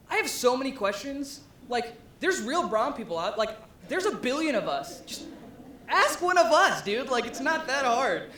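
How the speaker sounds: loud, high-pitched comic exasperation; exasperated outburst. Real, not acted. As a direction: protest loudly in disbelief. Comic outburst about brown face ad.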